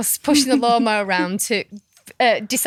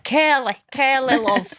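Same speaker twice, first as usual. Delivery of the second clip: louder, higher northern soap-character voice; northern soap-character impression, louder and higher; Raquel off Coronation Street; 4 semitones higher, 3 dB louder than usual. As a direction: fondly mimic a Coronation Street barmaid. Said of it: "bit Raquel off Coronation Street".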